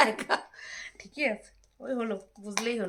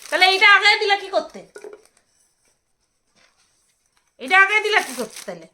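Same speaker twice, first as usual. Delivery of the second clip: exasperated complaint; 7 semitones higher, 10 dB louder than usual. Real, not acted. Complaining to husband.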